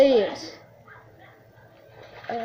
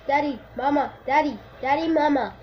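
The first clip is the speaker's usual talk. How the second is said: usual speaking voice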